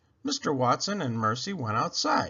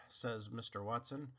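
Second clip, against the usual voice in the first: plain reading voice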